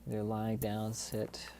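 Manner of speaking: guided meditation. Real, not acted. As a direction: lead them gently through the breathing, unhurried.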